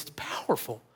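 hushed whisper of awe; barely voiced, imitating someone moved; retold awe, emotional. Moved young man to the pastor after prayer, whispered awe. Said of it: "why I'm so emotional right now".

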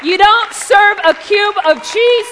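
loud, high, emphatic sermon proclamation; full-force preaching. Real, not acted. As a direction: proclaim it to the whole congregation.

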